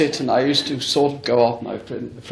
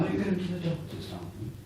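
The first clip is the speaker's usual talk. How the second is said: soft gentle question; 7 semitones higher, 14 dB quieter than usual. Retold or imitated. Gently asks the pregnant mother.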